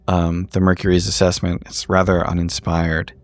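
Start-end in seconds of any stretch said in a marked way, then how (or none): none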